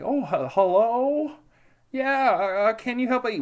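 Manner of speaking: very high, sing-out phone greeting; exaggeratedly nice. Answering scam callers on a buddy's advice, overly nice. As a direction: put on a sweet, high-pitched helpful voice. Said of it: "I started answering nicely".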